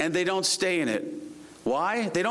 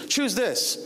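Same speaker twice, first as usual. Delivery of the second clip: higher-pitched, emphatic urging; urged choice; 6 semitones higher than usual. Retold or imitated. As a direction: push them eagerly toward this option. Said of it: "I feel like he's really saying".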